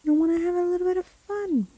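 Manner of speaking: high, quick, gleeful lilt; wickedly playful singsong; playful witch. Acted. Witch toys with her victim.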